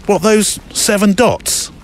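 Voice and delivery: imitating a doubting kid, high and drawn out; slow, high-pitched mock disbelief; incredulous child. Kid squints at mum's seven dots, incredulous.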